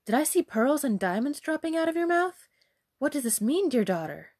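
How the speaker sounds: high, incredulous questioning; amazed cry. Acted. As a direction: ask in wide-eyed amazement. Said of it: "cried her mother".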